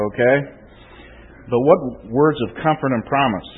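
normal talking voice

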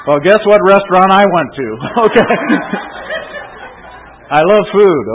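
loud, high-pitched comic admission with a chuckle; jovial confession. Real, not acted. Preacher joking about his love of food, loud and jovial. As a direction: own up gleefully, raising the voice.

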